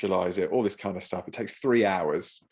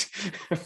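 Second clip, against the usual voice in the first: a high giggle breaking through; laughing at the thought of being trafficked; 10 semitones higher, 5 dB quieter than usual. Real, not acted. Laughing at his own fear of being kidnapped.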